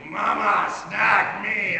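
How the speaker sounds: mock demon-child yell, pitched high and loud; inner demon voice. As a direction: unleash the possessed child, hammed-up. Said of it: "she, like, channels the inner demon".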